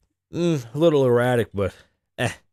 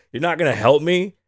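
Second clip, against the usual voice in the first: higher, louder rising question; indignant cashier; 6 semitones higher, 5 dB louder than usual. Retold or imitated. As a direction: mimic a clerk demanding help.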